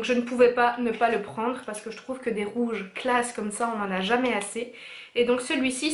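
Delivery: usual speaking voice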